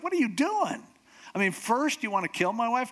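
high, indignant acted confrontation; outraged king. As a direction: confront him as an incredulous, angry husband. Furious king returns and confronts Haman.

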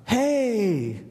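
high, drawn-out "hey" in imitation; sudden loud call. Man on a bench suddenly calls out, mock loud call. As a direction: imitate a stranger calling out of nowhere. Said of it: "he says really loud".

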